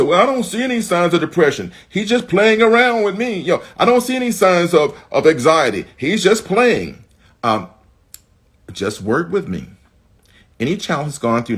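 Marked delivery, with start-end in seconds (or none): 0.0-7.0 high, loud brushing-off of concerns; mock a parent waving it away, pitched up; higher-pitched, insistent imitation of a parent in denial; dismissive parent. Mimics dismissive parent's objection.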